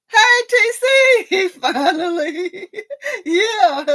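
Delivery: very high, drawn-out squeal into laughter; gleeful greeting. Real, not acted. Host greeting T C at last, gleeful squeal. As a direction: squeal hello, then dissolve into laughter.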